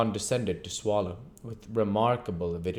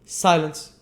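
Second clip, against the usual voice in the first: loud high sharp order; stern command; 8 semitones higher, 8 dB louder than usual. Acted. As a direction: slam the word down. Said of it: "cried Mister Bumble sternly".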